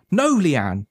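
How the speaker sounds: high-pitched protest, yell held back; mild narrated yell. A narrator's reading. Boy cuts off his sister's tale, mildly yelling. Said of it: "he yelled".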